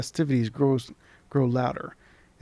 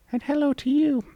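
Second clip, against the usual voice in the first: much higher, squeaky sprite voice; charming tiny creature; 14 semitones higher, 4 dB louder than usual. Acted. Tiny creature charmingly greeting the traveller. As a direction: chirp it like a tiny fairy. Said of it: "the creature replies, charming".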